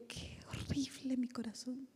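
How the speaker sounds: hushed confessional whisper. Real, not acted. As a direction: breathe it out as a secret confession. Confessing intimately to congregation.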